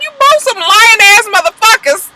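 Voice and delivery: very high, incredulous scolding; mock outrage. Vlogger retelling her retort to the lying couple, mock outrage. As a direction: call them out in a high squawk.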